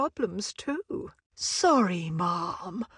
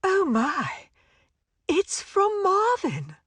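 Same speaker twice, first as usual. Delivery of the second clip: surprised; 6 semitones higher than usual. Acted. Surprise at finding Marvin's letter.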